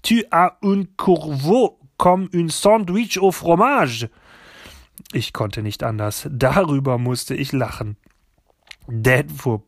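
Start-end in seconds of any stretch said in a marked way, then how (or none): 0.0-4.1 high, loud exasperated French insult; scolding French grandmother. A narrator's reading. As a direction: tell him off in indignant French. French grandmother scolds her son. (aufgebrachte Großmutter auf Französisch)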